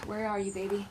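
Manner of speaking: breathy whisper to a crystal; tender whispered call. Real, not acted. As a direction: coax it out in a hush. Talking softly to her crystal.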